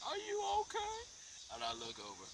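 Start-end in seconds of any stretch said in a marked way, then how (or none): 0.1-1.0 a squeaky, high-pitched put-on voice asking are you okay; high mock concern. Mimicking the man checking on her, high and squeaky. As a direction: squeak it out in a silly falsetto. Mocking a man's concerned question.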